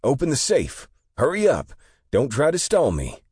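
high, very loud robber yelling orders. A narrator's reading. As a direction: scream the orders at the clerk. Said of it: "the young man yell again".

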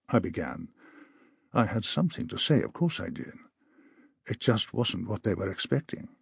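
ordinary delivery, nothing marked

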